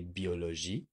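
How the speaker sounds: biologie said the French way, ending in a soft zhee; French pronunciation. Shown as a demonstration. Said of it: "would sound like in French".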